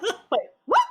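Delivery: laughing, high and loud wait what; a laughing, stunned double take; laughing disbelief. Retold or imitated. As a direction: caught off guard, half laughing. Laughing at her own confusion.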